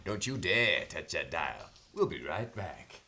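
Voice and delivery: low, hushed TV host sign-off; deep late-night host voice; announcer voice. Acted. Host's mock announcer sign-off.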